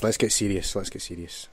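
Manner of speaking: increasingly low and quiet; dropping to serious. Real, not acted. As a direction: settle down, voice fading away. Repeats it while dropping voice.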